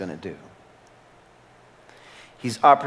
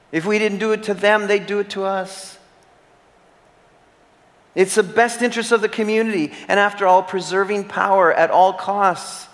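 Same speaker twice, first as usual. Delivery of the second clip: higher, louder voice mocking the tyrant's excuses; impassioned preaching; 7 semitones higher, 4 dB louder than usual. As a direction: preach it, voicing the tyrant's logic with scorn. Voices Hazael's self-justification.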